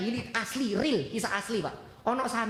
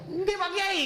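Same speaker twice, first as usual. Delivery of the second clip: high-pitched, over-keen yes; acted eager, deferential pupil; eager acceptance; 10 semitones higher, slower than usual. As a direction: jump at the offer, voice leaping up. Santri accepts marriage offer eagerly.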